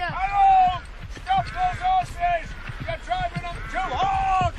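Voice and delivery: high, loud, drawn-out yell of Stop; furious yell. Acted. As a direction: stop him before he kills the horses. Farmer yelling at boy.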